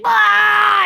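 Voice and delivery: sudden high loud scream; startled piercing plant scream; plant shriek. Pretend. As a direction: shriek as you get yanked out. Plant shrieks when pulled up.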